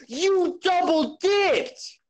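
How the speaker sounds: high, drawn-out mock yell; hammed-up accusing scream, slow; retold accusing shriek, stretched out. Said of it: "practically screamed".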